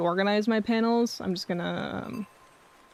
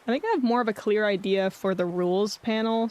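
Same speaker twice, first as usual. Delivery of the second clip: ordinary talk, nothing marked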